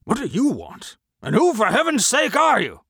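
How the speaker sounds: a rich merchant snapping at an intruder; irritated old merchant. Acted. Merchant confronts the boy.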